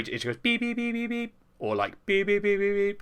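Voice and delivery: high, sung beep beep beep; electronic beeps. Retold or imitated. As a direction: chirp it like a little machine. Imitating the toy's beeps.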